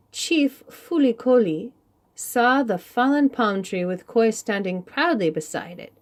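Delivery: plain reading voice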